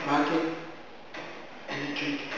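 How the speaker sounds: rocking and drinking refrain fading out. A narrator's reading. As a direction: let the chant die away. Refrain describing the master drinking.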